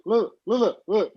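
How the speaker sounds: ordinary delivery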